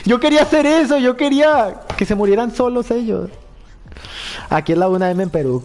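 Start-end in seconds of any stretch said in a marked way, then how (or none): 0.0-3.3 high, loud exasperated rant; loud laughing despair; frustrated outcry. Real, not acted. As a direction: wail over a silly death. Dies in the game, reacting.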